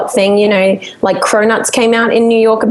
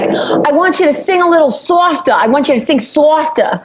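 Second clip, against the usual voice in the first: insistent Long Island studio guy, pitched up; Long Island accent; 6 semitones higher than usual. Retold or imitated. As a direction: repeat the request in imitated Long Island accent. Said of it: "And he's like".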